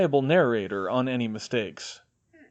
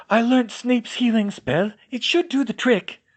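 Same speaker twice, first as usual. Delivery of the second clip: Hermione voice; 11 semitones higher, 5 dB louder than usual. A narrator's reading.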